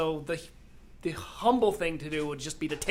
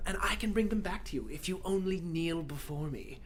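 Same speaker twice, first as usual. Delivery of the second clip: a light, quiet dark lord voice; lightly voiced villain demanding kneeling; 6 dB quieter than usual. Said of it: "you actually sound like Alex".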